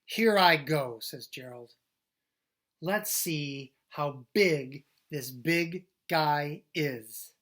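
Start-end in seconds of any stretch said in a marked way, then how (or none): 0.0-1.0 loud brave call; loud heroic here-I-go; bold announcement. Acted. Gerald setting off bravely. 2.8-7.3 very slow, stretched boast; slow, loud swagger; drawn-out bravado. Acted. Gerald sizing up bully.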